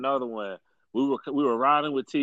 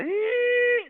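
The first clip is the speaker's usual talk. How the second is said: very high imitated brake squeal; mock brake screech. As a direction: squeal it like bike brakes stopping hard. Bike brakes screeching in story.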